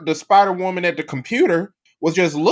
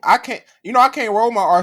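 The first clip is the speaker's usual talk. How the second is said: usual speaking voice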